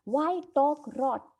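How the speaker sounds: slow, deliberate reading of a play line; dramatic play reading. Acted. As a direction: read it out slowly like a script. Teacher reads play aloud.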